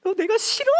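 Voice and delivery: high, loud crying wail; wife crying on the phone over the missed flight; imitated sobbing wife. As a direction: wail like a heartbroken girlfriend. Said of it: "또 제 아내가 울기 시작하는 거야".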